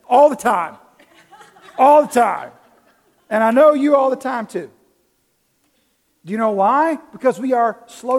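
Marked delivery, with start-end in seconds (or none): 0.1-4.7 laughing, louder confession; real chuckle through the words; laughing admission. Real, not acted. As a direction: laugh it out as you own up. Laughing admission of own failing.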